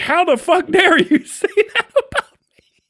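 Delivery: high, loud mock-offended laughing yell; mock outrage. Retelling reaction to TikTok claim, mock outraged yell. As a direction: play the insult as outrageous, half laughing. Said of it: "I went".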